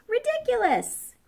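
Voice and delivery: high-pitched indignant cry; high exclamation. A narrator's reading. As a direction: cry it out high, as a storybook crocodile. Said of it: "cried the crocodile".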